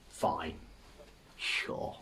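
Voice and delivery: usual speaking voice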